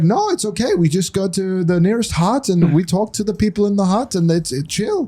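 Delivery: high, carefree mimicry of the guide; imitating Mario. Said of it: "He's like".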